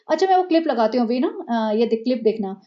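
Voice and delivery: normal talking voice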